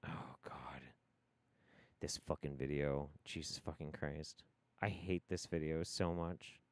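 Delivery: low, hushed disgust; quietly exasperated. Real, not acted. Dreading the next video he hates, quiet exasperation. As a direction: grumble it low, worn down. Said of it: "because I'm angry about".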